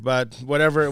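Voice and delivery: normal talking voice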